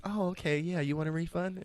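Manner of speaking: high, bright customer-service smile; smiling sweetly at the customer; cheery service voice. Retold or imitated. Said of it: "he had a big smile on his face".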